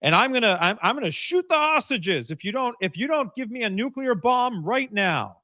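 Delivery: hammed-up raving gunman; angry hostage taker. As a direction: ham up an unhinged hostage taker's demands. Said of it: "the hostage taker is, like, out of his mind".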